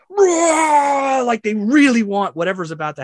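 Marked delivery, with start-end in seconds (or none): 0.1-1.2 high, loud roaring cheer; long, high crowd roar. Acted. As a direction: imitate a whole crowd erupting. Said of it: "everybody in the circle cheers".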